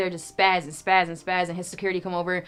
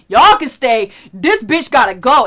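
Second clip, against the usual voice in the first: louder, slower, spat-out dismissal; mock angry yell; 5 semitones higher, 11 dB louder, slower than usual. Teller voicing the angry rapper kicking her out, loud mock yell. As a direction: bark it like a bouncer throwing her out. Angry man voiced in story.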